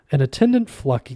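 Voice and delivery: plain reading voice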